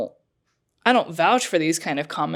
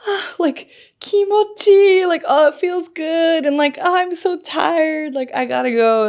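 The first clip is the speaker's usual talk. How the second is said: suggestive gym moans; 10 semitones higher than usual. Retold or imitated. Actress playing up her workout for the prank, mock suggestive sighing. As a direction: imitate her playing up the exhausted, satisfied noises. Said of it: "making all these noises".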